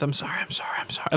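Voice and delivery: whispered, soft repeated apology; muttering under breath. Acted. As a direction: mutter it barely audible. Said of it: "he's muttering under his breath".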